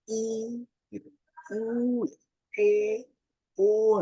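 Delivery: I, U, E, O held long and high; mouth wide open. Shown as a demonstration. Coach demonstrates singer's warm-up, wide-open high held vowels I, U, E, O. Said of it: "mulutnya dibuka maksimal".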